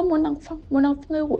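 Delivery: high pleading retold cry; mother calling after her child, high cry. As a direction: call mon enfant, mon enfant up high. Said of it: "elle commence à crier".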